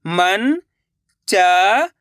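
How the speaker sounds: first part slow and drawn out, then the whole word said plainly; patient teacher reading words for children; sound-out reading drill. Shown as a demonstration.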